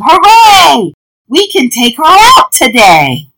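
high, loud cheer; excited yell. A narrator's reading. Students free to take the pet out, excited yelling. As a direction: cheer like students let loose. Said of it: "all his students yelled".

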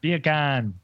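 "beer can" in a light put-on Jamaican accent; mock Jamaican accent. Doing his Jamaican bacon for his friends, lightly accented. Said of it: "that's my Jamaican bacon".

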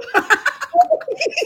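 real high, loud laughter; high cackle. Real, not acted. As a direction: crack up at your own joke. Laughing at own joke.

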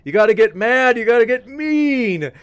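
movie quote shout. Retold or imitated. Quoting the angry teammate from Dodgeball, a loud pumped-up yell. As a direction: fire up the team like the Dodgeball teammate. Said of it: "there's this scene where he's like".

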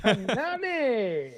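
high, very drawn-out surname in a douchebag voice; D-bag voice. Acted. Douchebag repeating the host's surname, D-bag voice. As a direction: stretch the name out like an obnoxious bro. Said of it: "that was my D-bag voice".

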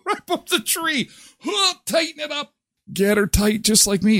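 squeezed high falsetto; pinched high voice as if squeezed; high squeaky voice. Pretend. Joking about a girdle.

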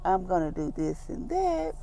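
high, hushed acted self-talk; soft, high-pitched, carefree reassurance; unworried self-reassurance. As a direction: softly voice someone calmly talking themselves down. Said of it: "you're not stressing and worry about it".

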